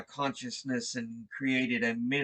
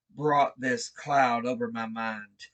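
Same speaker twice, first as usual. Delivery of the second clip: usual speaking voice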